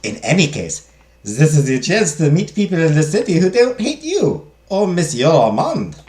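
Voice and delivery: put-on French-German accented servant voice; encouraging maid, accented. Maid urges madame to meet people, encouraging. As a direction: cheer madame on in the maid's accent.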